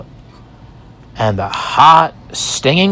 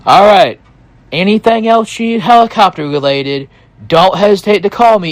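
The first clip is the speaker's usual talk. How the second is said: higher, fast, breezy pilot voice; Barry the pilot; 7 semitones higher, faster than usual. Retold or imitated. Helicopter pilot signing off to Ethan, higher and quick. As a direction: rattle it off high like a chatty pilot. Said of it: "and he said".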